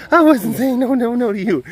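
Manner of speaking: high and loud, a squeaky voice for the cat; sweet, high-pitched baby talk to the cat; high pet-talk voice. Shown as a demonstration. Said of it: "same words redone".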